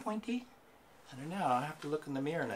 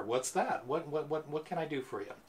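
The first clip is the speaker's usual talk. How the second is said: usual speaking voice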